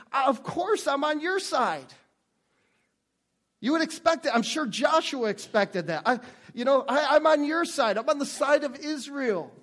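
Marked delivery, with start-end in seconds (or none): 0.1-1.9 higher, drawn-out mock reassurance; imagined assurance. Voices the answer Joshua expected. 6.1-9.5 higher, emphatic mock declaration of support; imagined assurance. Voices the answer Joshua expected.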